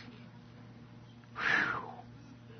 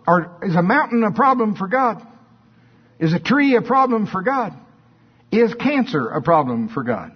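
preaching; 8 semitones higher, 3 dB louder than usual. Acted. Preaching rhetorical questions at full force.

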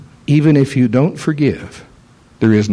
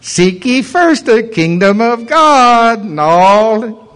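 very slow, higher, sing-song mockery; mocking demon; 8 semitones higher, 3 dB louder, much slower than usual. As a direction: drag the scripture out as a taunt.

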